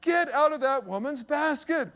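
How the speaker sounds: put-on high, slow drawn-out call to a child; mock yell. Father to son in a woman's basket, mock yell. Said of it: "so I just yelled at, at Mike".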